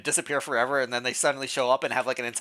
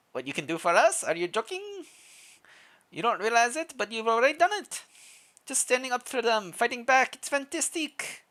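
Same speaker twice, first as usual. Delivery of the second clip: higher-pitched, vaguely Russian villager voice; vague Russian accent; 9 semitones higher than usual. Acted. Villager thanking the newcomer for standing up, vague Russian accent. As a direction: put on a loose Russian-ish accent, pitched up. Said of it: "Is that a Russian accent?".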